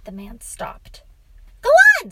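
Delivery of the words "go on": put-on high, loud little-boy voice; impatient boy. A narrator's reading. Boy prods his father to continue, impatient. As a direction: pipe up, urging the story on.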